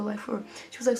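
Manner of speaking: ordinary delivery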